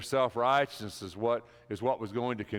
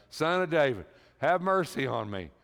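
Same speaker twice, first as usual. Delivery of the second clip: loud, higher cry for mercy; desperate yell; 5 semitones higher, 5 dB louder than usual. Acted. Blind beggar calls out to Jesus, yelling loudly. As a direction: shout it over the crowd, pleading. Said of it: "the louder he yelled".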